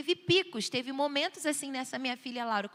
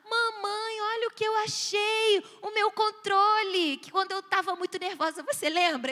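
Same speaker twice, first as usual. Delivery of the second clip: put-on high, delighted child voice; excited little girl; 6 semitones higher, 4 dB louder than usual. Daughter showing mum the paper remote, excited high voice. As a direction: imitate a child thrilled by her find.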